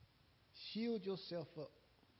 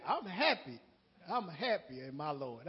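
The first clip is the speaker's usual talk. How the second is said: joyful declaration; 2 semitones lower, 7 dB louder than usual. Retold or imitated. Joyful answer to coworker.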